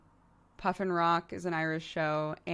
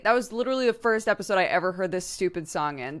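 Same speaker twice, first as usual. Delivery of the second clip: ordinary talk, nothing marked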